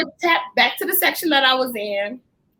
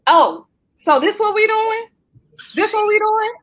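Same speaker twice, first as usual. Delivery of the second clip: sassy confrontation; 7 semitones higher than usual. Retold or imitated. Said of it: "So now I done leaned over to him".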